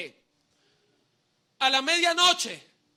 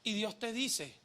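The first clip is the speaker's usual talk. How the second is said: ordinary talk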